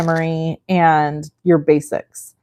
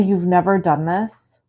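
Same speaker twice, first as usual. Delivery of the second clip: normal talking voice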